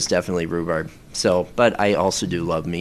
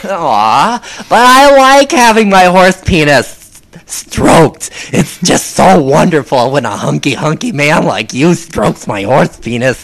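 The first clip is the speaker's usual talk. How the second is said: high, loud, over-the-top effeminate pleading; 7 semitones higher, 8 dB louder than usual. Pretend. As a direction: gush flamboyantly as a sparkly unicorn. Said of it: "I can do that voice".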